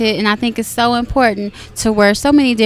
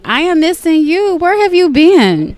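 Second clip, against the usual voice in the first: bubbly host, higher and louder; gushing red carpet chatter; 7 semitones higher, 5 dB louder than usual. Real, not acted. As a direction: gush over a favorite guest. Host greets favorite artist.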